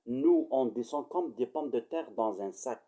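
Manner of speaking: ordinary talk, nothing marked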